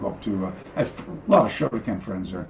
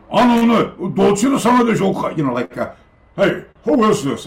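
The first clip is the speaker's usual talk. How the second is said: high, loud, curt Japanese phone voice; a gruff dojo master demanding who's calling; brusque senior teacher; 9 semitones higher, 7 dB louder than usual. Retold or imitated. Head teacher on the phone to him, curt and demanding. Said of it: "not an angry voice".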